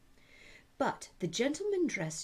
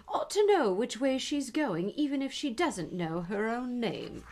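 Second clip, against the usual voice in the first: higher, louder prim tone; prim gentleman; 6 semitones higher, 5 dB louder than usual. Acted. Gentleman continues.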